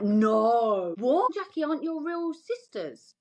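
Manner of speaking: high startled gasp of a question; shocked disbelief. Retold or imitated. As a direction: react like you can't believe it. Friend shocked on hearing of adoption.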